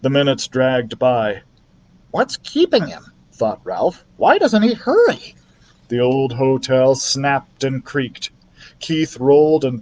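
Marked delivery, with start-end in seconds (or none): 2.1-3.1 anxious little mouse. A narrator's reading. 4.2-5.3 high, impatient mouse voice; anxious little mouse. A narrator's reading.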